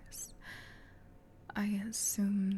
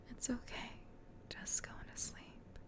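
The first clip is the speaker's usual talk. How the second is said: soothing; 3 semitones higher, 8 dB quieter than usual. Acted. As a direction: ease them gently into sleep. Lulling listener to sleep.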